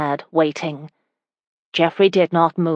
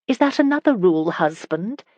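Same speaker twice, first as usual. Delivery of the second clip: teasing; 2 semitones higher than usual. Acted. Wife asks husband about his rule, teasing. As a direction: tease him lightly, lifting at the end. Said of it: "Elizabeth teased".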